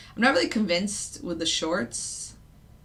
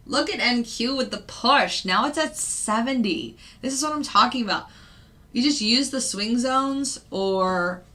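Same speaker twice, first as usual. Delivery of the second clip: excited; 6 semitones higher, 5 dB louder than usual. Real, not acted. Market pushes up as predicted.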